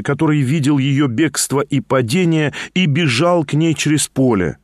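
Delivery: ordinary delivery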